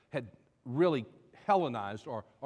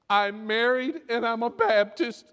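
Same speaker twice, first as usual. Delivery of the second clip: loud, high comic wail; mock weeping; 8 semitones higher, 7 dB louder, slower than usual. Cab driver confesses everything to the nun, mock bawling. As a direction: bawl it out as a hammed-up confession. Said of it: "he just began to weep and cry".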